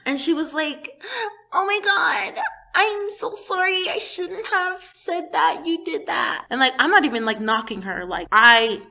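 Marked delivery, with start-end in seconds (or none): 1.5-6.5 high, slow, pleading imitation; mock tearful apology. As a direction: act out her remorse, drawn out and high. Voicing her remorseful apology in dream.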